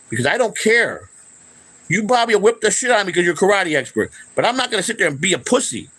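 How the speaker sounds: high heated challenge to a critic; defiant challenge to an online critic; angry callout. Real, not acted. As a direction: square up and call him out. Calling out a critic.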